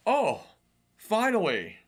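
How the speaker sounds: excited. Retold or imitated. Retold eager reaction to video.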